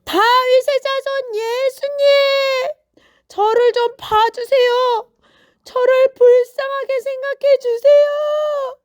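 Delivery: very high, full-voiced, sustained cry; all-out pleading holler. A narrator's reading. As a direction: yell at the top of your voice for mercy. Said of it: "아주 큰 소리로 소리를 질렀어요".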